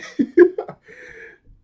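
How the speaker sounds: squealing, breathy laugh; high laugh. Real, not acted. Laughing at Jeremy's outburst, high and breathy. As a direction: burst into high giggles.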